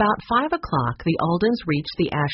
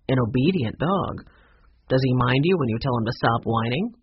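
lower-pitched motel manager voice; 4 semitones lower than usual. Acted. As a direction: play the manager, pitched down and curious.

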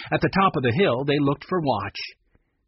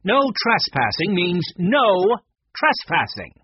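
slow, raised, emphatic rebuke; snapping; 6 semitones higher, 5 dB louder than usual. A narrator's reading. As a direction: lay down the rule sharply, word by word. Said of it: "she snapped".

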